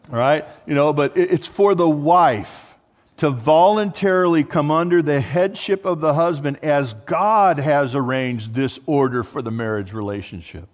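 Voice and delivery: ordinary talk, nothing marked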